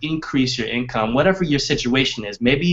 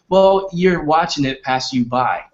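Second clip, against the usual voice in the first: normal talking voice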